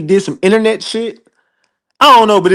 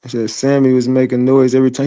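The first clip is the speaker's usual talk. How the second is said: ordinary talk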